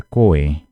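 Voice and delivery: "coen" emendado, sem pausa entre "co" e "en"; pronúncia emendada. Shown as a demonstration. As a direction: ligue "co" e "en" em "coen", sem corte. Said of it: "Não vão me pronunciar". ("coen" dito de uma vez, sem o corte)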